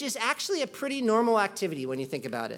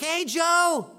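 higher, louder, hammed-up dad scolding a kid at dinner; mock scolding parent; 8 semitones higher, 7 dB louder than usual. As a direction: play an over-the-top father at the table. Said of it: "You're not like".

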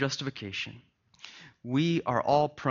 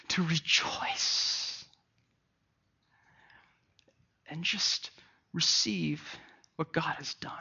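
softer, very slow near-whisper; hushed reverent close; 8 dB quieter, slower than usual. Real, not acted. Pastor quietly urges the church to receive grace, hushed. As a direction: let the words fall away gently.

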